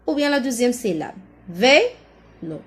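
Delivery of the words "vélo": "Vélo" in two beats, vé-lo; syllable by syllable. Shown as a demonstration. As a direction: leave a gap inside "Vélo". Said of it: "Dans la première".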